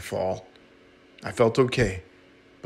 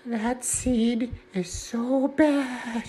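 gossiping seed; 13 semitones higher, much slower than usual. Retold or imitated. Other seeds gossip about him.

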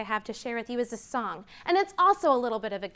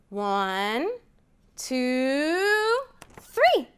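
building countdown; much slower than usual. Acted. Teacher counting to children, building to an excited three.

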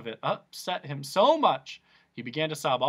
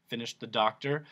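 ordinary delivery, nothing marked

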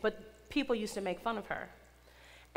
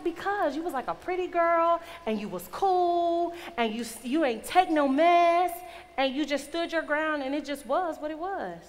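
higher voice, imitating a gushing schoolmate; imitated admirer; 6 semitones higher, 5 dB louder than usual. Schoolmate explaining why she looked up to her, imitated admiration.

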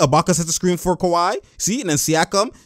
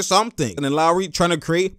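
ordinary talk, nothing marked

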